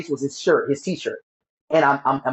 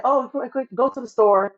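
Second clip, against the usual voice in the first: higher-pitched imitation of mom; retold urgent request; 9 semitones higher than usual. Mother sending her son to the store, retold urgent request. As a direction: voice mom's quick errand request, higher. Mother sends child on errand.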